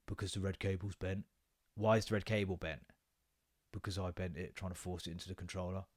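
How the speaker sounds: sheepish son confessing quietly; quiet confession. Retold or imitated. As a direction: mumble it like a caught kid. Son's hushed confession quoted.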